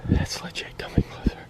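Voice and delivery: hushed frightened whisper; scared whisper. Real, not acted. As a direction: whisper while hiding. Hiding in bushes, someone walking.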